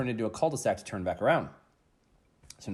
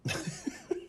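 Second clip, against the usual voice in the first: short breathy laugh; 9 dB quieter than usual. Real, not acted. Laughs after his own shout.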